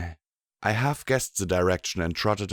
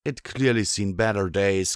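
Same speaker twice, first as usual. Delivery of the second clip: ordinary delivery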